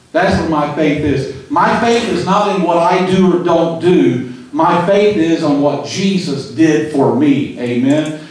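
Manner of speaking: ordinary delivery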